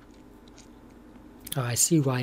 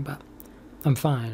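usual speaking voice

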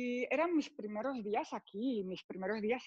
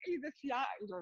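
usual speaking voice

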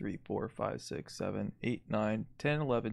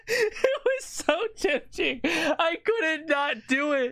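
laughing. Real, not acted. Dice roll goes wildly right.

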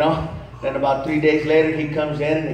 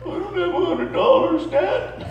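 high, whiny mock-tearful son; mock crying son; 13 semitones higher than usual. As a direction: whimper it like a teen. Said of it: "son's crying reply".

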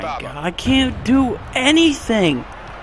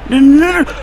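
panicked no; 6 semitones higher, 5 dB louder, faster than usual. Real, not acted. Opponent about to score.

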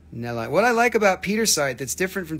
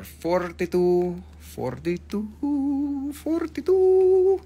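slow drawn-out repeats, each climbing higher; climbing price call; 10 semitones higher, much slower than usual. Pretend. Seller calling out the item's price, slow climbing singsong.

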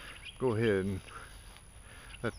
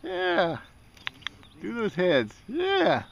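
much higher, louder sing-song "yeah"; high cooing at ducks; 10 semitones higher, 4 dB louder, much slower than usual. Real, not acted. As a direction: coo at the birds like pets.

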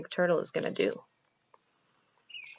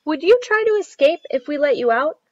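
put-on a higher, louder child's question; lightly voiced child; 9 semitones higher, 11 dB louder than usual. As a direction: ask it like a curious child. Child questions caged Turtle.

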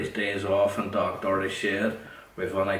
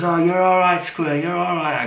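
high, louder put-on old woman voice, slowing at the end; imitating Annie; 9 semitones higher, 8 dB louder than usual. Annie reassuring Square, high imitated old woman voice. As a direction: coax it in her high voice. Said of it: "She goes".

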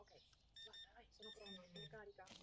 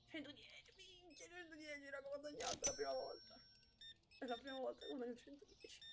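higher, louder thrilled exclaiming; loud joyful disbelief; excited; 6 semitones higher, 7 dB louder than usual. Real, not acted. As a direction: shout the number like a jackpot. Thrilled at earning 110.

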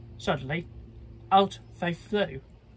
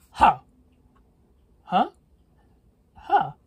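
scoffing; 4 semitones higher, 10 dB louder than usual. Acted. Wolf scoffs at pigs' plea.

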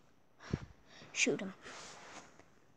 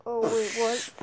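usual speaking voice

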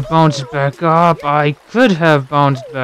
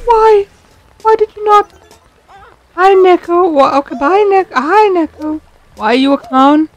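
very high, cutesy pet-talk voice; squeaky high voice. Pretend. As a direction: squeak like talking to a kitten. Squeaky voice talking to Neko.

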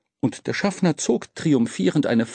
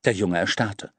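ordinary delivery

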